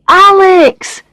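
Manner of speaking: high, loud, delighted greeting call. A narrator's reading. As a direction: hail a friend you have finally found. Barry calls from behind flowers.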